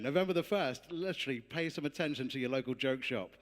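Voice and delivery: usual speaking voice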